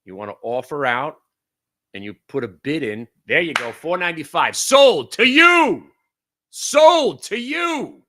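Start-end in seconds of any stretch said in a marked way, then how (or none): 4.6-5.9 gleeful sold call. Real, not acted. Order fills at four ninety-five. 6.5-8.0 gleeful sold call. Real, not acted. Order fills, repeated call.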